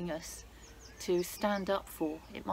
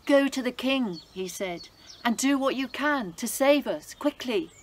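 put-on a raised, pressing plea from an old uncle; urgent uncle; 4 semitones higher, 7 dB louder than usual. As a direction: push her to act now. Mordecai urges Esther.

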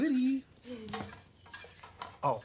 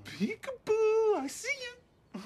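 singsong peekaboo; 8 semitones higher, 3 dB louder, slower than usual. Acted. Peekaboo game with baby.

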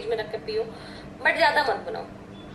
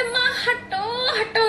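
son's whine; 7 semitones higher, much slower than usual. Retold or imitated. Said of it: "कहते".

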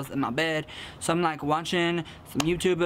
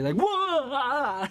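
mock startled whoa; 7 semitones higher than usual. Imagining suitemates startled.

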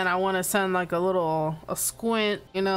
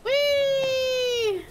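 joyful whee; 4 dB louder than usual. Real, not acted. Gliding off a height.

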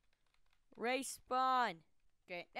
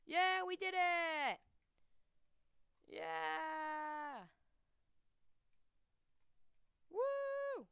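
excited cheering; 3 semitones higher, much slower than usual. Real, not acted. Celebrating a win.

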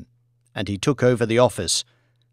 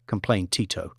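usual speaking voice

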